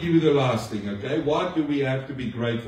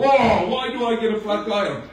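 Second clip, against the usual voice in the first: mock complaint; 7 semitones higher than usual. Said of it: "and you go".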